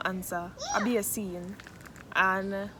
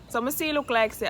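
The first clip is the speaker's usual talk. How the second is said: ordinary talk, nothing marked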